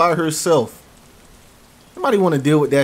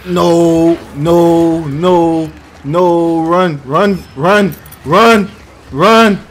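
panicked shouting, higher and louder; terrified horror victim; 3 semitones higher, 8 dB louder, much slower than usual. Real, not acted. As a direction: yell it while fleeing in terror. Fleeing in panic in game.

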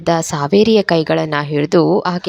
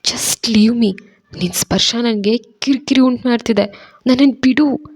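higher, strained moaning plea; faint voice pleading to be let go; 6 semitones higher than usual. A narrator's reading. Saveri fainting in Randhir's arms, moaning. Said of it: "ಅಂತ ನರಳಾಡ್ತಲೇ".

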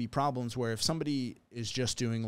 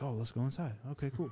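dog voice; 3 semitones higher, 3 dB quieter, faster than usual. Pretend. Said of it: "I like your dog voice".

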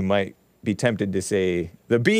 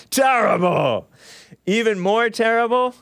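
mock outrage; 10 semitones higher than usual. Real, not acted.

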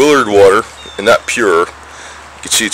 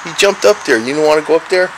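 pet talk; 6 semitones higher than usual. Real, not acted. Talking to the cat.

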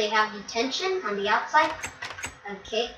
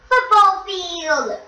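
drawn-out, higher, louder call of the room name; announcing; 9 semitones higher, 7 dB louder, slower than usual. Pretend. Touring a game school, calling out each room with a slow loud announcement. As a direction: announce it like a game-show host.

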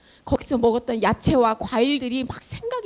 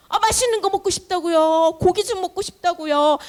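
acted complaint; 4 semitones higher than usual. Foreigners among Israel demanding tasty food and meat, complaining. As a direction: gripe like a fed-up crowd wanting better food. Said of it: "하면서 불평불만을 하고".

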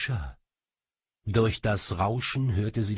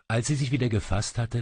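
usual speaking voice

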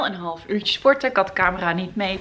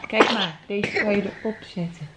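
ordinary talk

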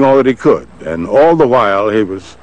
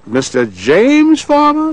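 high, lilting Southern lady on the phone; Southern female voice. Retold or imitated. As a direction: play the president's Southern secretary placing a call. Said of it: "Southern female voice said".

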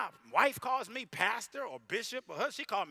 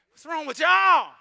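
loud, slow, high mock scold; mock outrage; 9 semitones higher, 16 dB louder, slower than usual. Real, not acted. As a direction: scold the crowd in jest.

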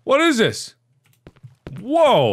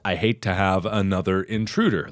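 very low, soft note voice; reading a note; 12 semitones lower, 6 dB quieter than usual. Acted. Player reading Norman's note, low soft voice. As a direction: read it out in a deep hushed register. Reads the game's note aloud.

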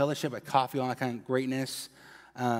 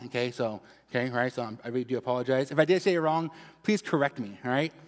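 ordinary delivery

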